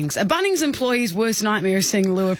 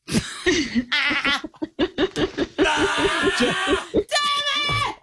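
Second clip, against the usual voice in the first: burst of laughter; 10 semitones higher than usual. Real, not acted. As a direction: crack up, caught out. Prank caller is caught out.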